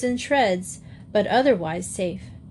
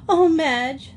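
high, louder tearful cry; sobbing; 7 semitones higher, 5 dB louder than usual. A narrator's reading. Joey to Madge after the train crash, sobbing. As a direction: wail it through sobs, in character. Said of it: "sobbed Joey".

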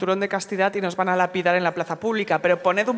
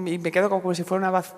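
ordinary talk

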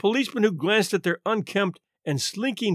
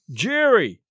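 eager call, a little raised; crying out; 4 semitones higher, 4 dB louder than usual. A narrator's reading. Tall man spots Murray under the streetlight, crying out. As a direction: call out the name on a raised pitch. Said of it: "cried the tall man".

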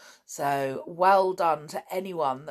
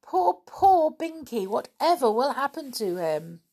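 high-pitched dismayed lament; starts high and loud, easing down; despairing cry; 8 semitones higher, 6 dB louder than usual. A narrator's reading. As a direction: wail with worry for a lost friend. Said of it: "cried Molly in despair".

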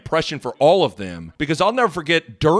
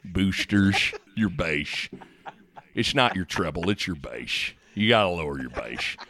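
deep, low, quiet coach voice; Coach Altman impression; 9 semitones lower, 6 dB quieter than usual. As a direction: drop way down into a deep coach rumble. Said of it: "Coach Altman would, like, have me do the".

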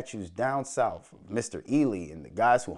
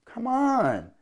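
exasperated plea; 11 semitones higher than usual. Real, not acted. Exasperated at scouting department.

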